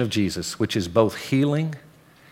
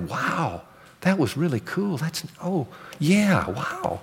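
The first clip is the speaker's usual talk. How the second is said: hushed awe; 5 semitones higher, 5 dB quieter, slower than usual. Retold or imitated. Member awed after modeled worship.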